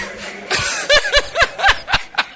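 long, high cackling laugh; helpless high-pitched laughter; real laughter. Real, not acted. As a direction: lose it laughing mid-story. Laughing during the retold story.

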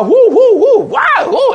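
whooping high and loud like an excited crowd; hoop and holler. Retold or imitated. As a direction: mimic a rowdy revival shouting whoo. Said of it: "what they call the hoop and holler church".

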